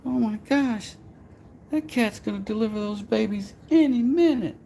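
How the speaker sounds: very high, helpless falsetto damsel voice; helpless lady. Pretend. Helpless lady asking for someone strong, high falsetto.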